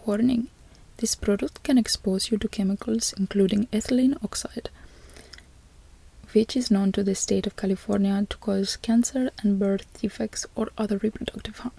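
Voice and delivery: whispered mock Indian accent reading a warning label. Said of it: "read this cancer warning ... in an Indian accent".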